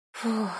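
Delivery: soft sigh of relief, breathed out; relieved exhale. A narrator's reading. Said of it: "с облегчением выдохнула я".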